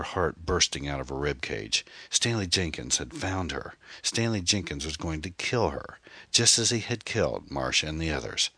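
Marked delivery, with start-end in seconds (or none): none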